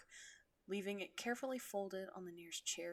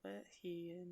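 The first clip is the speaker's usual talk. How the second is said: ordinary delivery, nothing marked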